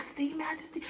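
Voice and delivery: unvoiced hushed warning; whisper. Mom scolding daughter in a whisper. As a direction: whisper it to scare her quiet. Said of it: "I was like".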